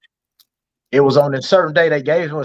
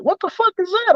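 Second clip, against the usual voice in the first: mock disbelief. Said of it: "They were like".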